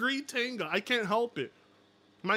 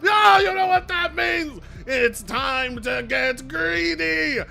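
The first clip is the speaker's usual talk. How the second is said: higher and louder, stretched out; excited shout; 9 semitones higher, 7 dB louder, slower than usual. Real, not acted. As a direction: yell with greedy glee at the donation. Donation arrives, shouting in glee.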